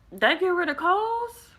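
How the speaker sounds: high, slow disbelieving question; incredulous. Acted. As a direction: ask it like you can't believe it. Incredulous question about cures.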